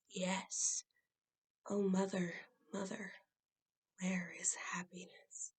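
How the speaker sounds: fading to a whisper on where is happiness; soft closing line. Acted. For students, the wife whispering her question to her mother. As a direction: let the last question die away. Quiet ending of the lament.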